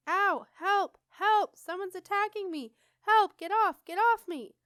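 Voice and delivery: very high, loud alarm shrieks; piercing high scream. A narrator's reading. Alarmed lock thinks it is attacked, screaming high. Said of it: "screamed a piercingly high metallic voice".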